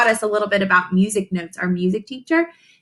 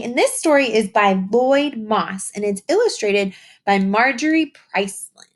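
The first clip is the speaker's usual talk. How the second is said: usual speaking voice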